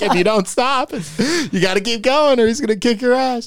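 high, loud laughing retelling. Real, not acted. Telling friends about his brother, laughing high. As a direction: laugh while you explain.

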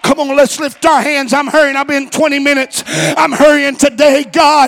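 high, loud preaching exhortation; shouted altar call. Real, not acted. Preacher calling people to lift hands, shouted altar call. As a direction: urge the congregation on at full voice.

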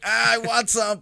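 eager craving. Retold or imitated. Dad voicing his own craving.

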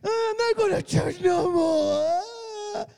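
high, drawn-out sing-song wail; mock hurt feelings. Preacher mimics offended churchgoers, exaggerated weepy whining. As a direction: whine it slowly like a tearful, exaggerated sulk. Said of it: "be all in your feelings".

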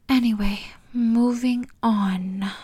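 ordinary talk

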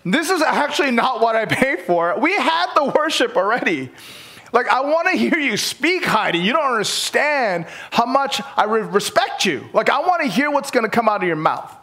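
high, pleading mock whine; mock complaint. Fan pleading with Heidi to speak, high mock whine. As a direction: beg her to start preaching. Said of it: "I start to go".

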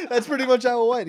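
normal talking voice

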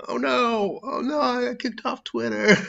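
high, hammed-up whiny wail; mock dismay. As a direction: fake panic, squeaky and high. Mock dismay echoing own statement.

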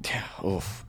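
wincing whisper. Real, not acted. Wincing at sad image.

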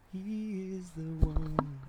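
slow, swooning sigh; dreamy. Pretend. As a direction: draw it out like you're in love. Mock dreamy repeat of line.

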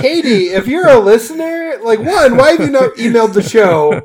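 loud, high and laughing; excited laughing plea. Real, not acted. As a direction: gleefully call out to her. Begging a listener to get in touch.

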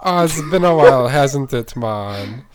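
put-on a light Jamaican accent, quick; a breezy Jamaican greeting, drawn out on man; mock Jamaican accent. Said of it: "Jamaican accent".

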